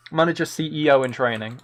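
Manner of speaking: ordinary talk, nothing marked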